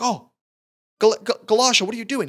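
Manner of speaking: high, loud, startled cry of her name; sudden alarm. Retold or imitated. Retelling him finding her in the mud, alarmed. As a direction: snap out of it and gasp her name in shock. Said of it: "he's like".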